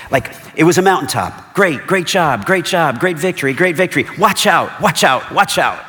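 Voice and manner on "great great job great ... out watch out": high, loud calls of praise turning into a warning; shouted praise then warning. Retold or imitated. Prophet praising the victory, then shouting the danger to Asa. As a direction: cheer the win, then yell the alarm. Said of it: "It's like he's saying".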